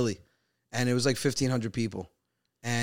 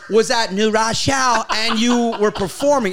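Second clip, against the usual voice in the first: mock tough guy; 9 semitones higher, 5 dB louder than usual. Mocking friend's tough voice.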